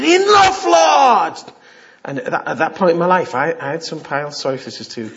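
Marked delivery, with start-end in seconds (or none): 0.0-1.4 very high, loud holler; imitated shout. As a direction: shout it out like a wild prayer. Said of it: "and shouted".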